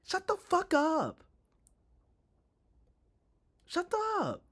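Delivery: very high, loud, drawn-out send-up; mock angry yell. Streamer imitating the actor's unbelievable anger, mocking. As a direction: overdo a badly faked angry squeal. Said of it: "his eyes were like".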